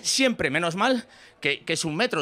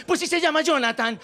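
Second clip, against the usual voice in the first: higher, exasperated dad's comeback; exasperated father; 6 semitones higher than usual. Acted. Dad arguing with daughter over a nickname, exasperated. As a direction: argue it like a fed-up father.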